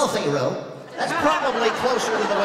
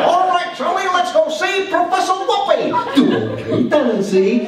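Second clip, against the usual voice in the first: much higher, loud squeaky cartoon voice; 12 semitones higher, 7 dB louder than usual. A kid imitating Saturday morning cartoons, high squeaky voice. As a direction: pitch it way up for the cartoon. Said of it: "sounded like".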